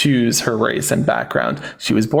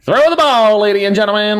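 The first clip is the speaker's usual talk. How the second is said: much higher, louder hammed-up barker call; loud, high mock carnival barker; 6 semitones higher, 4 dB louder than usual. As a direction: shout to the fairground crowd. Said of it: "I feel like the carnival person".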